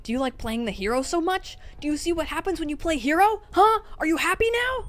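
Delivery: escalating anguished tirade; furious snarl. A narrator's reading. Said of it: "snarled at him".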